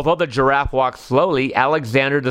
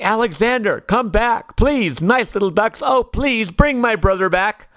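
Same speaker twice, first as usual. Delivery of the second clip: put-on high, anxious child's plea; storybook child calling after someone; pleading call; 6 semitones higher than usual. A narrator's reading. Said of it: "runs along the bank calling".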